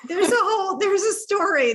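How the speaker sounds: bursting with laughter as she starts; laughing. Real, not acted. Friend laughing as she starts the donkey story, laughing.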